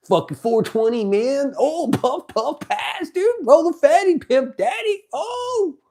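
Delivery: stoner hype building. Acted. Streamer hyping a bong hit for chat, climbing giddy stoner yell.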